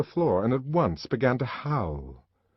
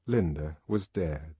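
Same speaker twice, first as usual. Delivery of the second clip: usual speaking voice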